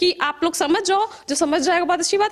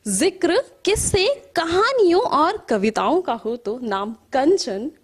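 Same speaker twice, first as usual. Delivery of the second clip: measured verse with rising and falling cadence; poetry recital; 4 semitones lower, slower than usual. Acted. Poet reciting to an appreciative audience, measured poetry recital. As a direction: recite in lilting lines, letting line endings sink.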